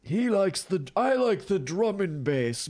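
high, drawn-out upper-crust English; upper-crust British accent. Acted. Host quoting a raver's love of drum and bass, mock upper-crust accent. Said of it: "like, an upper crust, uh, type of accent".

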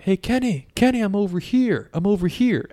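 put-on high girl's voice calling out; lightly voiced girl calling Kenny. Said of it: "Elizabeth's voice being like".

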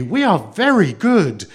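high, loud, slow emphatic assurance; eager. A narrator's reading. Children assure the girl of goodness, eager. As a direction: insist like keen children. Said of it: "they answered eagerly".

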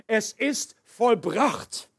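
slow, drawn-out proclamation from the cross; a slow, solemn cry of completion. Acted. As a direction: stretch each word like a final call. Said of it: "rief vom Kreuz aus".

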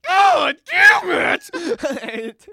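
very high, loud yell of pain; pained shout. Retold or imitated. Catcher yelling after his elbow is bashed, loud pained shout. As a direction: scream it as the bat hits. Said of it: "I was like".

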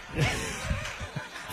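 soft breathy burst of laughter; airy laugh. Real, not acted. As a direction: laugh it off, unvoiced. Laughs after forgetting.

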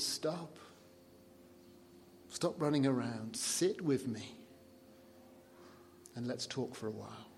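quiet and tender, calling a weary friend to stop; gentle Jesus. Retold or imitated. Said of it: "Jesus adopts a very different tone of voice when he's speaking to his people".

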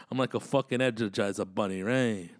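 normal talking voice

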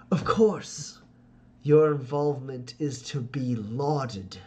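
smooth, courtly schemer, slow and deliberate; scheming noble father. Acted. Ferdinand greets his son's companion in a courtly, flattering voice. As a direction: lay on the flattery like a silver-tongued lord.

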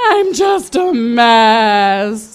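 slow, loud tearful cry; sobbing wail. Retold or imitated. Drunk narrator wailing to her sister in the car, sobbing. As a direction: drag out the sob. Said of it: "I sobbed".

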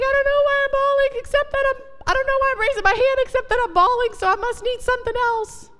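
very high, fast wailing imitation; hammed-up tearful squeal, rushed; mock bawling. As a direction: imitate a woman bawling through her words. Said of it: "she starts bawling".

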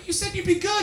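high-pitched, wailing complaint; comic protest shooting way up in pitch; mock hurt outburst. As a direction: whine it in a pretend tantrum. Mock outburst at silent audience member.

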